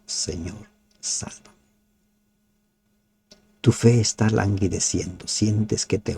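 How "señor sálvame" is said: soft, breathy cry for help; hushed desperate plea. Shown as a demonstration. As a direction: plead for rescue under your breath. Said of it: "Grita como Pedro". (súplica susurrada y desesperada)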